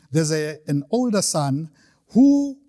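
ordinary delivery

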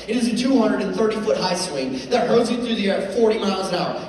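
ordinary delivery

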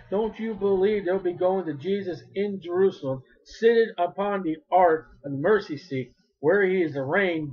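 normal talking voice

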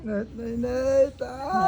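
high, slow cry from heat; pained wail. Real, not acted. Patient under fire invocation, wailing that it burns. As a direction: wail as if burning.

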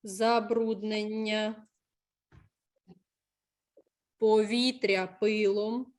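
slow, measured classroom dictation. Real, not acted. Teacher dictates text while pupils write it down, slowly.

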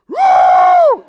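huge, high, loud whoop; hunter's whoop. Retold or imitated. Hunter in the deer stand whooping at his kill, full-voiced. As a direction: whoop like you just dropped a deer. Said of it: "in the deer stand going".

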